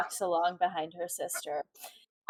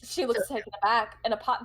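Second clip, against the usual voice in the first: ordinary delivery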